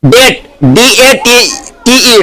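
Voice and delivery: letters डी ए टी टी ई spelled slowly; slow spelling out. Shown as a demonstration. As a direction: dictate it letter by letter. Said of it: "इसको alphabet भी बोलते हैं".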